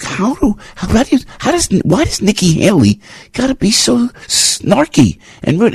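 very high, incredulous complaint; exasperated. Real, not acted. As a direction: squeal the question in disbelief. Exasperated rant about Nikki Haley.

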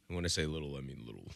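very low, soft aside; hushed emphasis. Real, not acted. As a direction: drop way down to stress smallness. Stressing how little the pay is.

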